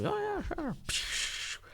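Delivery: a thin half-voiced "sure" from the other friend; mock reply.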